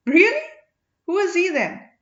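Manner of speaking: high loud astonished question; surprised. A narrator's reading. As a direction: gasp it out in disbelief. Valli surprised by revelation.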